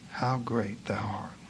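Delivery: slow, whispered awe; hushed prayer. A narrator's reading. Preacher voicing the mother praying amid the natives, a slow reverent whisper. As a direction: breathe it out slowly, low and very soft. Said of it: "she prayed".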